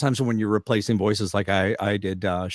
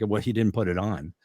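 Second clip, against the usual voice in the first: normal talking voice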